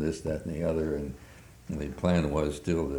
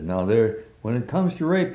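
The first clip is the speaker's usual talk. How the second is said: put-on Southern accent; 6 semitones higher, 5 dB louder than usual. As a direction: imitate a thick Southern accent. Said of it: "with a thick Southern accent".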